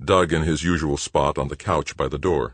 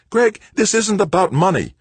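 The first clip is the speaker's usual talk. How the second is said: much higher and louder, pleading hard; heated insistence; 7 dB louder than usual. Acted. Brother insisting to the director, raised and urgent. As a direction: push back with full force.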